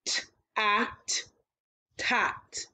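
segmented T, ah, t then blended into tot; sound blending. Shown as a demonstration. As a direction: say each sound apart, then join. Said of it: "change the mm to a t".

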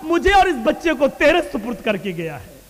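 high, fervent cry to God; impassioned plea in preaching. Retold or imitated. Preacher voices a wife entrusting herself and child to Allah, impassioned plea.

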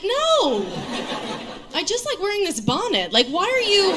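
high-pitched protest; mock indignation. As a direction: protest in hammed-up offence.

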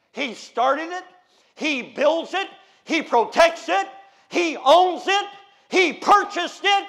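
loud, slow, building pulpit cry; loud preacher's cadence; preaching. Acted. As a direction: thunder it from the pulpit. Preaching at full force.

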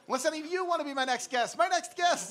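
high, loud shout to the room; loud, high crowd call-out. Real, not acted. Calling out to the audience.

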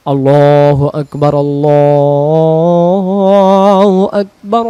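drawn-out adhan, আল্লাহু held long; call to prayer. Shown as a demonstration. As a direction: call it out like a muezzin on television. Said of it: "যেমন টেলিভিশনে আজান দেয়".

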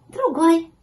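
high, loud kitten voice; high-pitched little cat; confirming kitten. A narrator's reading. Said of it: "подтвердил котёнок".

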